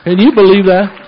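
loud, high, amazed exclamation. Real, not acted. Preacher marvels to the church about the prophecy, loud and amazed. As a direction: throw it out to the congregation in wonder.

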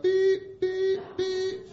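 high, loud monotone beeps; imitated reversing beep. Driver backing the truck up, imitated warning beeps. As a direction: mimic a backup alarm at a high pitch.

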